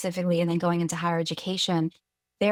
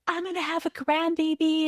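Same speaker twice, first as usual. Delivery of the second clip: much higher, giddy grandmother squeal; thrilled mom; 10 semitones higher than usual. Retold or imitated. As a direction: imitate mom bursting with joy. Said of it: "She was so excited".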